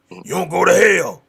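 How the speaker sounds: loud preacherly warning; mock condemnation. Mimicking church folk at unchurched kids, loud condemning call. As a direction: thunder it like a quick judgment.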